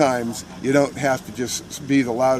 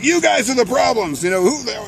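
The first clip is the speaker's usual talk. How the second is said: high-pitched accusing mock yell; indignant, raised-voice retelling; retold yelling; 9 semitones higher than usual. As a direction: pitch it up like heckling someone. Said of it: "The guy I'm yelling at".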